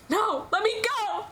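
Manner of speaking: put-on high, slow desperate cry of a struggling boy; frantic child's protest. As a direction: plead high and slow to be let go. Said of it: "he protests".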